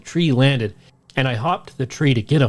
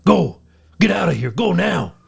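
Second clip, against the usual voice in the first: loud, higher mock yell; retold yell; 5 semitones higher, 3 dB louder than usual. Narrator yelling at Jacob to run, loud mock yell. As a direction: shout him away to safety. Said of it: "I screamed at Jacob once more".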